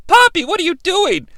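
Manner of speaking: high-pitched mock questioning; Medeja impression. Partner questioning Steiner's pipe rampage, high mock voice. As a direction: imitate a startled partner, voice jumped up. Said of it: "and Medeja's like".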